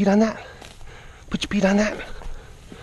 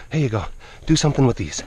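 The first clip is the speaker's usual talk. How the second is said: usual speaking voice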